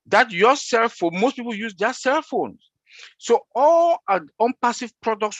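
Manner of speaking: high-pitched, emphatic insistence; fired up. Real, not acted. Resuming the point after apologizing, fired up. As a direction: jump back in high and fired up. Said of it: "when I'm fired up, I talk like this".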